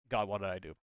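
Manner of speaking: ordinary talk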